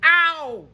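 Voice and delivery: high, sharp yelp of pain; a sudden yelp as she lands hard. Acted. Character falls in the muck.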